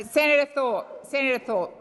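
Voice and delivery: loud, firm calling out a name. Real, not acted. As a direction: cut through the noise with her name. President calling over interrupting senator.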